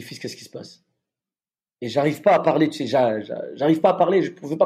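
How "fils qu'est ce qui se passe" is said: whispered, much softer question; hushed priest asking what's wrong. Retold or imitated. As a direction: whisper it gently to someone grieving. Priest comforting a crying soldier.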